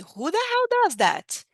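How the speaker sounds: high, slow, indignant English line; outraged disbelief. Shown as a demonstration. Teacher acts out an indignant question in English. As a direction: let the outrage soar up and stretch out. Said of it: "Tipo assim".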